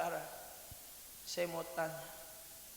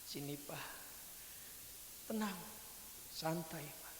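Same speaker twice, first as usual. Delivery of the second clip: soothing; 8 dB quieter than usual. Acted. Daughter calms her father down.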